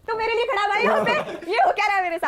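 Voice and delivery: retold outrage, half laughing; asking the policeman what is going on, in laughing disbelief; indignant disbelief.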